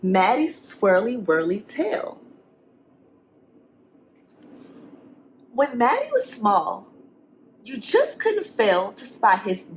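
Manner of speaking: lively, sing-song storybook reading; reading to children. A narrator's reading. Teacher reading a picture book to the class. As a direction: read it to the kids with rhyme.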